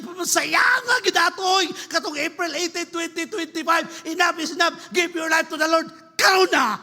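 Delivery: high, forceful call building to a shout; urgent exhortation. Acted. Preacher urges giving your life to the Lord, shouted exhortation. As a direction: drive it up to a shouted command.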